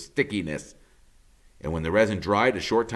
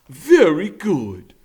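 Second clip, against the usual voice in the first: slow satisfied beaver; 9 semitones higher, 11 dB louder, slower than usual. Acted. Beaver approving the fit.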